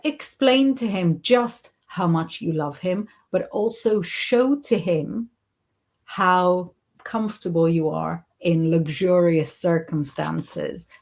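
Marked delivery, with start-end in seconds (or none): none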